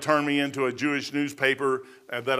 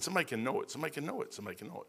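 fading to a hush; 3 semitones lower, 15 dB quieter, much faster than usual. Retold or imitated. Repeats realisation, fading away.

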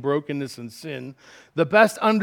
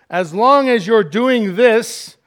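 high, loud, slow condition; demanding; 6 semitones higher, 10 dB louder, slower than usual. Acted. Partner setting terms for love, insistent and demanding. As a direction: lay down the terms forcefully.

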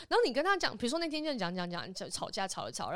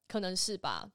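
normal talking voice

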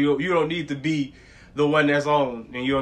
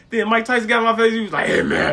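rapid, higher-pitched mock chatter; 7 semitones higher, 4 dB louder than usual. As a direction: imitate a rapper rattling off a story. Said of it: "he talks so fast".

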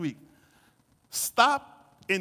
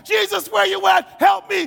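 loud, high desperate cries; frantic, pleading holler; freaking out; 10 semitones higher, 10 dB louder than usual. Acted. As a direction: shout a panicked plea for help. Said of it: "I'm freaking out".

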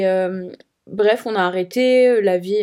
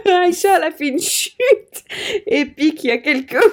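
raconte en pouffant, voix haute; en riant; 6 semitones higher than usual. Real, not acted. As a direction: rire dans la voix. Laughing while telling.

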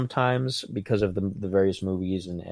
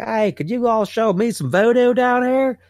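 high, put-on clueless tourist; goofy tourist voice; 12 semitones higher, 3 dB louder than usual. Friend mocks a clueless tourist in New Orleans, high put-on voice. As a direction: ham up a tourist begging for voodoo. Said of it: "nobody from Ohio talks like that".

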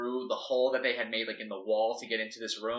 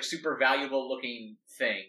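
ordinary delivery

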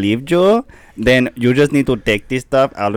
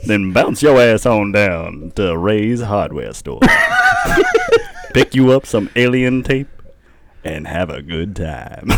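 hammy ad read. Acted. Hammed-up ad pitch.

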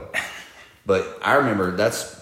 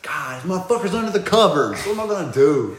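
higher, put-on exasperated voice; mock despair, pitched up; thwarted killer; 10 semitones higher, 4 dB louder than usual. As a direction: play a movie killer comically stumped. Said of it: "he looks at me, he's like".

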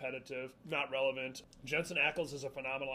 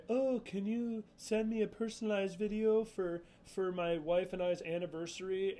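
mock fan request; 5 semitones higher, 3 dB louder than usual. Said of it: "like, say".

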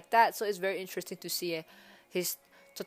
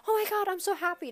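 put-on much higher, gushing New Yorker kid accent; childlike New Yorker accent; 13 semitones higher, 3 dB louder, faster than usual. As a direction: gush it like an excited New York kid. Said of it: "it's like a New Yorker childlike accent".

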